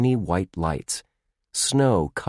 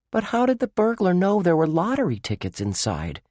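put-on high, curious young boy; 11 semitones higher than usual. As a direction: ask it like a puzzled kid. Josh asks a puzzled question.

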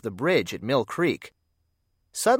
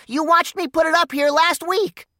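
squeaked; 5 dB louder than usual. Acted. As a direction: squeak it out, much higher and louder. Clarence pleads with Bugs.

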